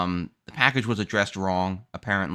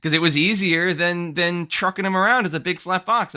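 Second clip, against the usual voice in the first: higher and louder, laughing; excited; 8 semitones higher, 5 dB louder than usual. Real, not acted. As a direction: rant with laughing disbelief. Laughing rant about movers.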